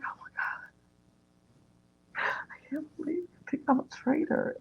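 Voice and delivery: slow gasped whisper rising high; mock freak-out. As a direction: imitate someone stunned, whispering in disbelief. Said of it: "she's freaking out inside".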